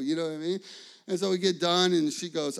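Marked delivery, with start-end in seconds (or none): none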